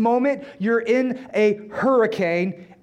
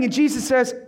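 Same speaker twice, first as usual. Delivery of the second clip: normal talking voice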